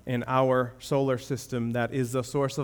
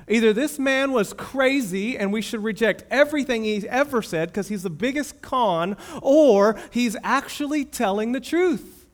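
raised, insistent preacher's challenge; emphatic preaching; 8 semitones higher, 4 dB louder than usual. Acted. Preacher pressing the congregation to decide, emphatic and raised. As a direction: press the choice on them hard.

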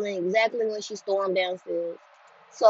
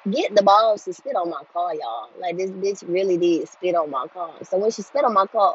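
usual speaking voice

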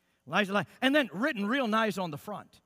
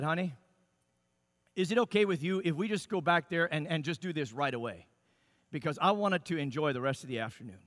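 softer and lower, coaxing his wife kindly; gentle request; 6 semitones lower, 5 dB quieter than usual. Retold or imitated. Husband sweetly asks wife to write the letter now. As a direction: ask sweetly so she agrees quickly. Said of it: "I said".

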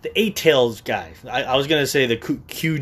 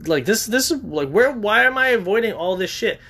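surprised realization; 8 semitones higher than usual. Retold or imitated. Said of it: "I'm like".

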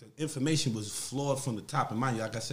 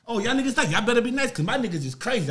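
louder, higher cocky boast; talking shit; 7 semitones higher, 6 dB louder than usual. Retold or imitated. Speaker boasting to the Baltimore riders, louder cocky swagger. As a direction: brag hard to rattle them. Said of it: "I start talking shit".

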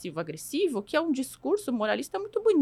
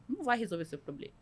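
usual speaking voice

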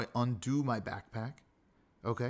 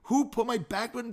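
huffy complaint; 9 semitones higher than usual. Retold or imitated. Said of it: "and get all huffy".

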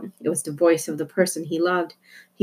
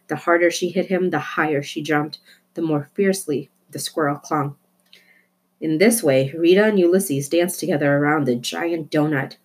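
usual speaking voice

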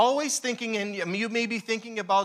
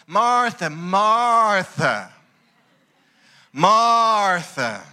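louder repeated call of her name; 9 dB louder, much slower than usual. Acted. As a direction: call her name again and again to get her attention. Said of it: "He even had to say her name twice".

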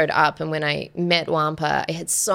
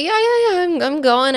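retold exasperated agreement; 12 semitones higher, 5 dB louder than usual. Retold hasty giving in to voice.

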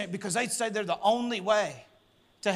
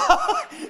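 sudden squealing laugh; real laugh breaking out; high burst of laughter; 6 dB louder than usual. Real, not acted. Preacher cracks up.

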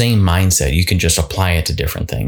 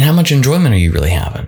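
normal talking voice

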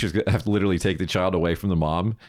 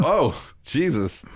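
shocked; 6 semitones higher, much slower than usual. Retold or imitated. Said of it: "And I was like".

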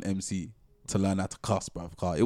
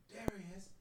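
quiet retold call; 10 semitones higher, 18 dB quieter than usual. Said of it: "is hearing".

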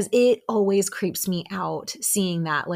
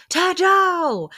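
high, loud imitated outcry; exasperated group shout; 13 semitones higher, 7 dB louder than usual. Cast answering her feigned confusion, exasperated shout. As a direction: yell it high like a fed-up crowd.

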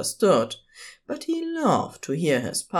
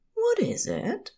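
fond partner voice; 3 dB quieter than usual. A narrator's reading. Aziraphale curious about present.

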